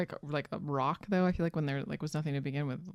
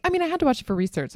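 mocking friend; 9 semitones higher, 4 dB louder than usual. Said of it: "You're like".

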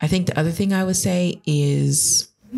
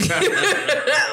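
high-pitched burst of laughter; laughing; 12 semitones higher than usual. Real, not acted. Guest laughs after listing labels for her voice, high amused burst. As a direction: crack up at your own joke. Laughs at own list ending Becky.

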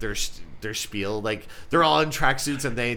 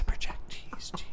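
sexy whisper; 6 dB quieter than usual. Pretend.

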